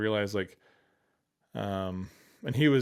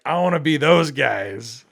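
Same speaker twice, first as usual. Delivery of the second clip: very loud, slow, higher-pitched outburst; excited longing; 5 semitones higher, 14 dB louder, slower than usual. Retold or imitated. Help desk worker spotting programmers, loud excited longing. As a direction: declare it with drawn-out, comic yearning.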